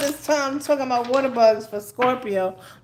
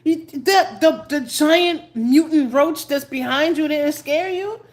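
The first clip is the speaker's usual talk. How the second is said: higher and louder, incredulous; startled customer; 7 semitones higher, 5 dB louder than usual. Retold or imitated. Customer startled by her scream, high incredulous voice. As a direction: mimic a shocked customer. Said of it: "a customer there who was like".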